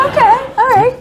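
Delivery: high, bright, loud okay; chirpy agreement. Retold or imitated. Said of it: "they're like".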